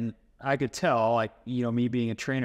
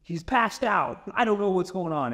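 high, urgent, mildly panicked report; a touch of panic, pitched up; retold freaking out; 6 semitones higher than usual. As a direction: reenact a panicked cry for help. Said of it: "I'm just freaking out".

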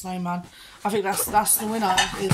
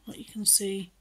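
whispering; 7 dB quieter than usual. Real, not acted. Vlogger whispering about the treats to the camera. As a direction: drop to a hush.

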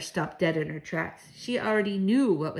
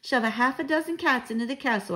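higher-pitched eager proposal; excited cry; 6 semitones higher than usual. A narrator's reading. Child proposes the cat plan, crying out. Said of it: "I cried".

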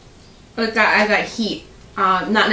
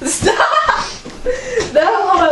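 laughing; 11 semitones higher, much slower than usual. Real, not acted. Mouth burning, laughing for milk.

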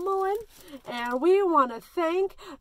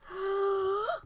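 sharp audible inhale; deep breath in; 6 semitones higher, 4 dB quieter than usual. Shown as a demonstration. Teacher leading breathing practice, deep inhale. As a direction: suck the air in big. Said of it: "deep breath and hold it".

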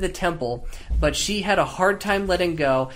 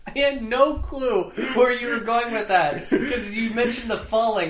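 laughing; 7 semitones higher, 4 dB louder than usual. Real, not acted. Laughing after swallowing water.

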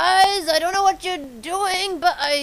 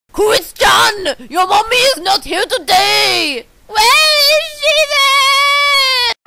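crying shout; 4 semitones higher, 5 dB louder than usual. Acted. Scolding Chris in a role.

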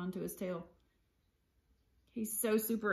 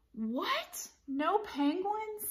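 high, incredulous storybook voice; stunned little cat's question; dismayed disbelief; 9 semitones higher, much slower than usual. A narrator's reading. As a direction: ask it in high-pitched disbelief. Splat hears pool is closed.

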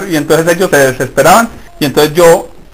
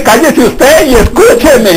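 stern divine rebuke; 5 semitones higher, slower than usual. Acted. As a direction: scold him slowly, half laughing. God answers the believer, quoted.

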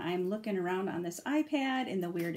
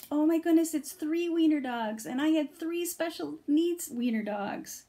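thrilled delight; 6 semitones higher, 4 dB louder than usual. Real, not acted. Delighted at wiener dog card.

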